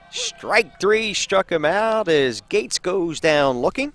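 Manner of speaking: slow, drawn-out play-by-play call; sportscaster savoring the third strike; strikeout call. Real, not acted. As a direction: stretch out the strikeout like an announcer. Calls a strikeout live.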